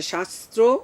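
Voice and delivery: শাস্ত্র ending in a round o, shas-tro; pronunciation demo. Shown as a demonstration. Teacher shows the final o, careful articulation. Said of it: "ওকার উচ্চারণ হবে শেষে".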